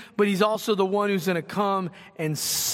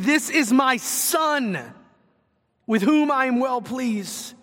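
higher and slower, a proud public endorsement; proud God affirming; 5 semitones higher than usual. Retold or imitated. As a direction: proclaim it as God vouching for his son. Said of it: "He's, he's saying".

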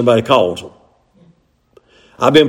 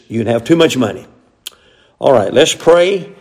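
ordinary talk

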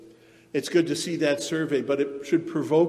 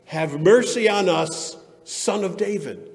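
crying out loud; 5 semitones higher, 6 dB louder than usual. Acted. Blind men following Jesus, crying out loud. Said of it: "crying out loud".

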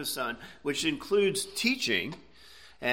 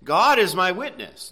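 GOD hit higher and louder in "God is my witness"; emphatic quote; 5 semitones higher, 7 dB louder than usual. Retold or imitated. Preacher quotes Paul stressing his point to the Romans, emphatic. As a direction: land hard on GOD, then fall through "my witness". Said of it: "he's emphasizing the point".

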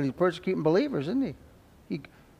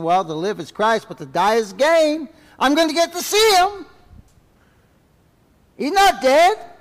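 much higher and louder, ringing; fervent preaching; 10 semitones higher, 8 dB louder than usual. Real, not acted. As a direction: shout the hope out. Preaching climax.

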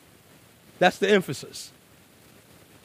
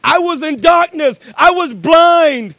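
testimony cry; 10 semitones higher, 10 dB louder, slower than usual. Retold or imitated. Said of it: "And they say".

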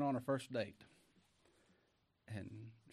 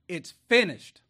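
a ringing, much louder cry; 5 semitones higher, 15 dB louder than usual. Retold or imitated. Preacher voicing Jesus on the cross, a loud cry. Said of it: "With a loud cry".

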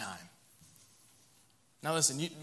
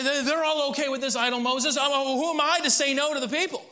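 swinging high, pleading excuses; Aaron shifting blame; 7 semitones higher, 3 dB louder than usual. Acted. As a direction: whine your way out of it. Aaron blaming the people.

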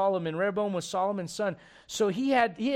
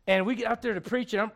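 ordinary talk, nothing marked

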